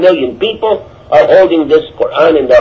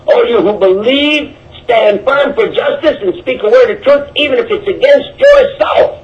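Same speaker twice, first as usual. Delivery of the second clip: quoting scripture; 4 semitones higher than usual. Acted. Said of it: "our Quran says".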